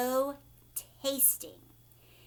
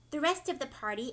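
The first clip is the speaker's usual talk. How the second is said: ordinary delivery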